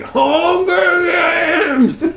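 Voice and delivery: extremely drawn-out, high mock wail; mock dramatic shout. Podcaster breaking into his own plot summary, absurd mock shout. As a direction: stretch the title out absurdly high.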